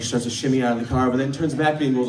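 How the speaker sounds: usual speaking voice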